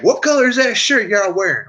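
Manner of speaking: twangy Southern question; Southern accent impression. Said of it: "they're like".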